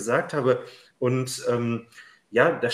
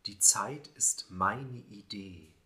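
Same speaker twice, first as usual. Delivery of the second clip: poem recitation; 3 semitones lower, 6 dB quieter than usual. Acted. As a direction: recite it like a quiet lyric poem. Poem read aloud.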